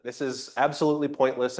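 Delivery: normal talking voice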